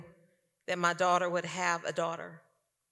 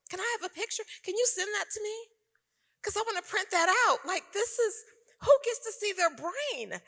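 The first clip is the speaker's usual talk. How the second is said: higher, airy voice full of wonder; marveling awe; 13 semitones higher than usual. Retold or imitated. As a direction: gaze at it like a miracle. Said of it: "And I'm marveling".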